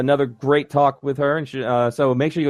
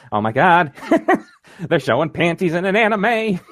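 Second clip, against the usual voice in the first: usual speaking voice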